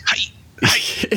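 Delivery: high, breathless little "hi, hi"; mock eager greeting. Boss wants the junior to chirp an eager hi.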